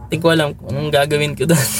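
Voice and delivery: ordinary talk, nothing marked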